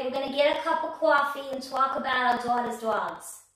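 hammed-up fifties New York accent; playful stab at old New York speech. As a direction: put on a broad New York coffee-talk accent. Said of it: "Please tell me that that is a good accent".

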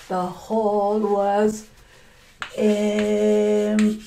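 slow, stretched-out telling; drawn out. Shown as a demonstration. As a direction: stretch each word out slowly for effect.

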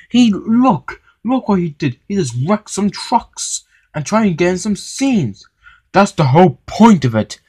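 high-pitched indignant back-and-forth; heated arguing. A narrator's reading. Engine and manager argue over wrecked trucks, heated. Acted quarrel between Henry and manager.

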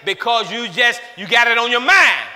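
loud, high preacher's cry; preaching at full force. Acted. Preacher pressing the crowd to forgive.

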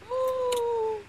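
long, high gasp of excitement; delighted gasp. Real, not acted. Delighted at hearing the house.

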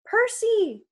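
high, loud cry of a name; panicked yell. A narrator's reading. Grover calling his friend from the bed, yelling. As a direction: yell for help from your friend. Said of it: "Grover yelled".

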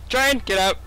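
loud, high warning yell; panicked shout. Real, not acted. Train coming.

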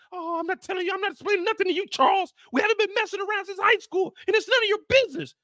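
high, sassy retort; lightly voiced sassy wife; mock high wife voice. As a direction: answer like an offended wife. Lucille snapping back.

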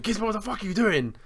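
high-pitched retold telling-off; exasperated, high-pitched demand; mock scolding.

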